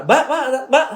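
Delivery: mock restaurant call-out; calling a waitress. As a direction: call the waitress over, acted out. Acted diner calling a waitress over.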